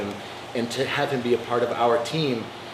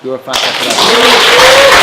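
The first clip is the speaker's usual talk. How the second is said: slow, high, loud proclamation; triumphant shout; 11 semitones higher, 15 dB louder, slower than usual. Real, not acted. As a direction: proudly shout it out. Proud belt award announcement.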